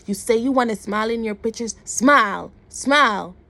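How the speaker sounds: loud mock-barked command; imitating a man shouting impatient demands; retold angry order. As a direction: bark the last words like orders. Retold man ordering her to smile.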